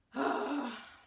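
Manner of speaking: long loud weary sigh; heavy sigh. Real, not acted. Heavy sigh before telling about intensive reading. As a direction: let out a heavy exhausted sigh.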